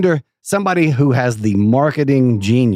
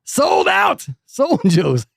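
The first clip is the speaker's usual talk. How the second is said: high, slow, stretched-out announcer yell; shouted SOLD OUT, drawn out for show; mock hype shout; 13 semitones higher, much slower than usual. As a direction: holler the brag like a hype man. Repeats the brag, shouted.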